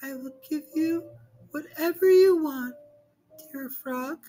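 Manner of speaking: very high, eager princess; promising anything to get her ball back. Acted. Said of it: "said the princess".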